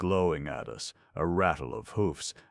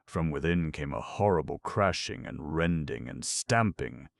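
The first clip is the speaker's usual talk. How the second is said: plain reading voice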